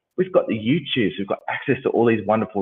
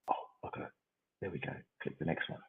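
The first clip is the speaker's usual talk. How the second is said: muttered aside; 5 semitones lower, 20 dB quieter than usual. Real, not acted. Muttering to himself over slides.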